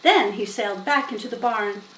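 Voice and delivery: usual speaking voice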